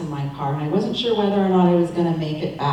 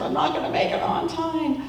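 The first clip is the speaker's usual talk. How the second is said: worried thought; 11 semitones higher than usual. Retold or imitated. Worried driver in traffic.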